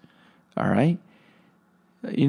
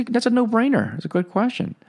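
normal talking voice

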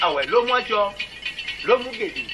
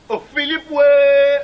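loud drawn-out cry of ouais; loud call; 3 semitones higher, 5 dB louder, much slower than usual. Acted. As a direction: call the name, then belt ouais. Ritual calling chant.

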